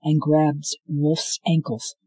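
ordinary delivery

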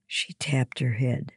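plain reading voice

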